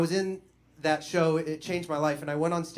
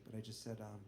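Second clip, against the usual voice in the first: ordinary delivery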